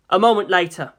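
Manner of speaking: plain reading voice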